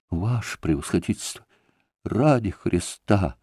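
captain stopping the doctor, softly frightened; frightened plea. A narrator's reading. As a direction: beg the doctor in a small scared voice. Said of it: "Испуганно остановил его".

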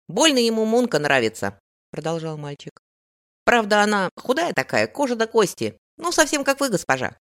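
put-on loud, chatty street kid; boy voice. A narrator's reading. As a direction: gossip like a cheeky boy.

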